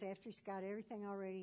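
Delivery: ordinary delivery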